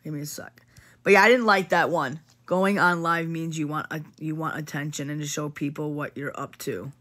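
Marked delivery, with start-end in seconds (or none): 0.0-0.6 quick real whisper aside; hushed off-mic whisper. Real, not acted. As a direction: drop to a whisper, stepping away from the mic. Streamer pauses, speaks off-mic.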